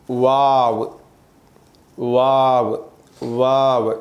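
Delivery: वाव with lips rounded forward; वाव said slowly, lips pushed forward round; articulated waw. Shown as a demonstration. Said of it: "ये वाव किस तरह अदा करना चाहिए". (वाव होंठ गोल करके)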